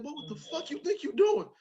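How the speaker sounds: high-pitched mock angry dad; scolding father. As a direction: play a woken dad scolding his boy. Father scolds the crying child.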